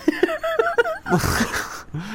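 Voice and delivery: high, drawn-out laugh; laughter. Real, not acted. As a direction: laugh at your own boast. Laughs after microphone boast.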